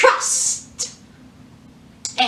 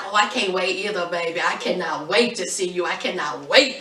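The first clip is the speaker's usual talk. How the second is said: low, soft, smooth-talking man, a little fast; sweet-talking boyfriend; 9 semitones lower, 5 dB quieter than usual. Retold or imitated. A lower, softer voice as Michael sweet-talks Megan on the phone. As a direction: croon it low like a lover sweet-talking his girl. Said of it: "he was like".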